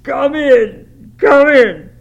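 anguished cry, very high and loud; wailing, full-voiced grief; sobbed plea. A narrator's reading. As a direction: call out desperately to a lost love. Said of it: "he sobbed".

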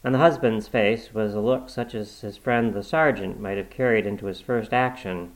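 ordinary delivery

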